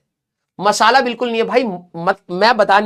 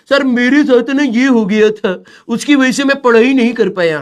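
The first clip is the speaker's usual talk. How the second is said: whiny excuse; 2 semitones higher, 4 dB louder than usual. Retold or imitated. Lazy student whining an excuse to his teacher. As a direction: drag out the excuse like a sulky pupil. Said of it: "दूसरे के ऊपर ठेलते रहेंगे".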